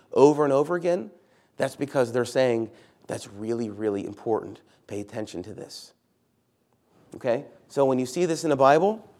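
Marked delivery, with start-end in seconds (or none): 3.1-5.9 low, soft, confidential aside; hushed emphasis. Acted. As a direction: lower and much softer, dropping away. Voices what repeated words say.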